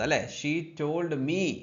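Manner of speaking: normal talking voice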